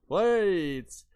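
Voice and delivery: high, loud, hammed-up ad voice, then dropping; mock hype announcer. Mocking TV sellers to viewers, hammed-up ad voice. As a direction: sell it like a cheesy advert.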